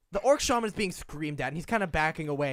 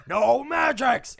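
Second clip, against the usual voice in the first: loud, slow, higher shout; angry orc shout; 7 semitones higher, 8 dB louder, much slower than usual. Acted. Orc shaman shouts the ban after firing at the wall, loud and slow. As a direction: yell the ban at the prisoners. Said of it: "and he says".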